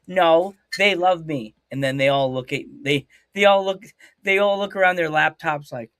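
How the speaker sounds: ordinary talk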